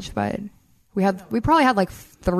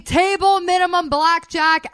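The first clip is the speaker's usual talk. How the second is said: high, drawn-out mock holler; mock drunken cheer, stretched out; retold screaming; 8 semitones higher, slower than usual. Friend imitating two drunks at the table, mock scream.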